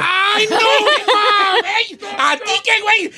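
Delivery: teasing high-pitched protest; mocking outcry. Real, not acted. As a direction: cry out in mock disbelief. Mock disbelief at friend's crying.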